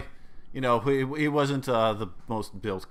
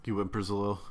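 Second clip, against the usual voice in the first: ordinary talk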